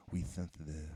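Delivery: "sensitive" said as "thenthitive"; lisp. Shown as a demonstration. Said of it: "I used to lisp when I was young".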